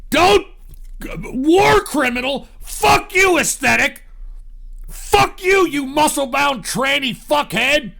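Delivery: yelling back at the chat; angry yell. Real, not acted. Shouting at chat insults.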